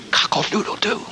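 small, half-voiced cock-a-doodle-doo; little bitty rooster crow. Shown as a demonstration. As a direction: crow feebly, holding the volume back. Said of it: "little bitty".

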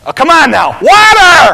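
loud, high shout back to camp. Acted. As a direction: holler it, ending on a big drawn-up yell. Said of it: "turns back to the camp and shouts".

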